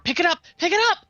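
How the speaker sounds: urgent high, loud call; quick yell. Acted. Player yelling quickly to Valifor mid-fight, urgent yell. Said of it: "I yell just real quickly to Valifor".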